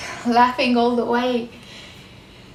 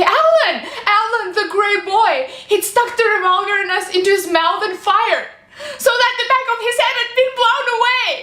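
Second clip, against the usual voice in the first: high, loud, racing tearful cries; hysterical horror; 10 semitones higher, 5 dB louder than usual. Acted. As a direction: cry out the horror, building to near-screaming. Relives voices crying out husband's suicide.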